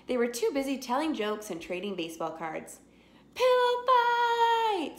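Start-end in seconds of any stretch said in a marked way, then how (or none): 3.4-5.0 high, slow, far-carrying shriek; screamed. A narrator's reading. Buster starting a pillow fight, shrieking. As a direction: stretch it out in a gleeful yell. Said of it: "screamed Buster".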